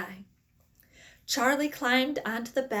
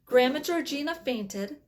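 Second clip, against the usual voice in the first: usual speaking voice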